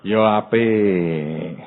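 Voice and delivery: drawn-out sarcastic. Acted. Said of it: "Tuhan ngomong gini".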